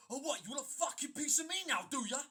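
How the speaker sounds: loud sneering threat; aggressive taunt. Retold or imitated. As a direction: taunt him like a hothead picking a fight. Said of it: "looks at me and says".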